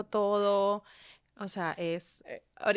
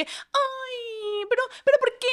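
high, crying whine, much higher; mock whiny cry; 12 semitones higher, much slower than usual. Mother imitating her preteen daughter's tantrum, high mock crying. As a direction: imitate a preteen's dramatic sob.

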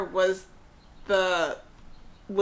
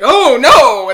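mock cri paniqué très fort; 5 semitones higher, 17 dB louder than usual. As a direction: crie oh no comme des fourmis prises au piège. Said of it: "and then they're like".

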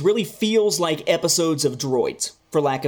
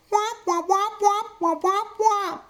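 high muted womp womp like the Peanuts grown-ups; trumpet-like womp for the adults' talk; Charlie Brown adult womp; slower than usual. Retold or imitated. Reviewer mimics Peanuts adults as wordless high womps. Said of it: "the Charlie Brown thing".

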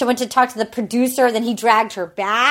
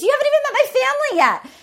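much higher, rushed mock disbelief; mock incredulous; 12 semitones higher, much faster than usual. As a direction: blurt it out in retold disbelief. Retelling his incredulous reply.

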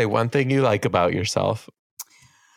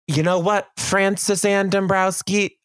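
mock grovelling apology; 8 semitones higher than usual. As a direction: imitate David over-apologizing. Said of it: "you're over here making a story like".

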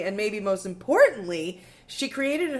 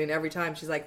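normal talking voice

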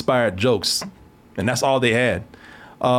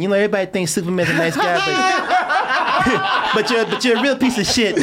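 high, laughing boxer impression; Mike Tyson; 7 semitones higher than usual. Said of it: "she was like Mike Tyson".

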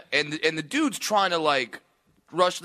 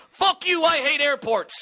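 high, loud mock outburst; mock angry heckler; 9 semitones higher, 3 dB louder than usual. Comic mocking the heckler's outburst, high and loud. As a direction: yell it like the heckler would. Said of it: "and he's saying".

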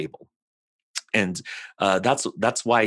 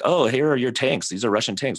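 higher, louder mock accuser; acted-out accusation, pitched up; pointing out evidence; 5 semitones higher, 3 dB louder than usual. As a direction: present the proof with lifted, emphatic pitch. Voicing accusers in retold exchange.

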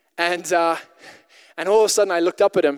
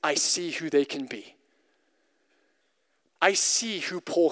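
soft, lower, gentle assurance; a quiet, lower, reassuring God voice; tender voice of God; 5 semitones lower, 5 dB quieter than usual. Acted. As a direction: speak it like a loving promise. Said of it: "He says".

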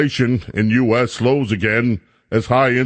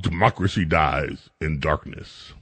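mock solemn motto; 10 semitones lower, 6 dB quieter than usual. Real, not acted. Repeating the motto mockingly.

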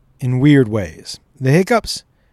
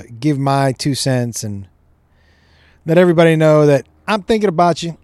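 ordinary talk, nothing marked

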